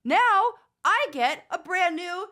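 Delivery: loud, high worked-up rant. Real, not acted. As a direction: build up the panic. Nightmare fuel rant, escalating.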